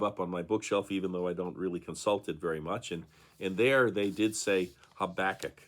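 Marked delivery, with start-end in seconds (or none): 5.0-5.6 Habakkuk with the middle syllable stressed, ha-BAK-uk; stress on second syllable. Shown as a demonstration. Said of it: "the way that I've always been saying it".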